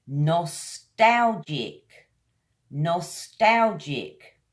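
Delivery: nostalgic stretched very slowly, falling; slow model word. Shown as a demonstration. Teacher modelling a word for repetition, slow. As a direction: say nostalgic slowly, every syllable. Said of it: "Three syllables".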